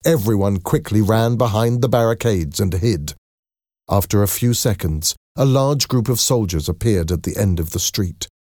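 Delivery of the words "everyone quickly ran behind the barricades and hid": ordinary delivery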